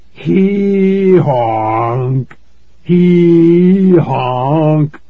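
loud, long, drawn-out braying honk; wild honking. A narrator's reading. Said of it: "these unbelievable honks came".

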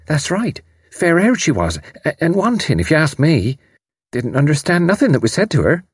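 high, chatty servant voice; servant girl. A narrator's reading. Servant chimes in about the foreign woman, chatty. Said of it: "The servant girl chimed in".

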